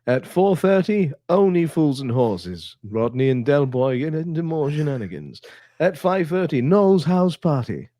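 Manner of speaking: high slow listings announcer. Retold or imitated. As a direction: read it like a festive continuity voice.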